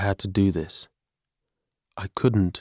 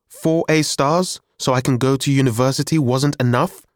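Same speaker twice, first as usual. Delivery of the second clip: higher-pitched, indignant challenge; 6 semitones higher, 4 dB louder than usual. A narrator's reading. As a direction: argue back at dad, wounded and cross. Teenager quarrelling with dad.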